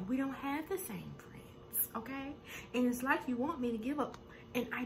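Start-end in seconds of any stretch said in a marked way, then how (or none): 0.1-2.3 soft, slow whisper with the voice dropped. Retold or imitated. Malaysia whispering the retort to Brandy. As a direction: breathe the retort as a secret.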